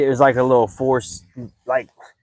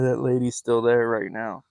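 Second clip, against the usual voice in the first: usual speaking voice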